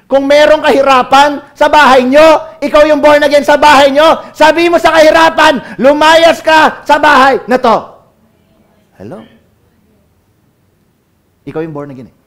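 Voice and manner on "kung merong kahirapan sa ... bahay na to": loud, high and slow, ordering it out of the house; forceful command. Acted. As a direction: drive the hardship out with a booming voice. Preacher commands poverty to leave. (malakas na pag-uutos)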